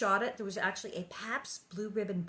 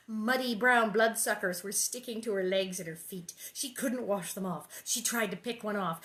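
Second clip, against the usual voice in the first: usual speaking voice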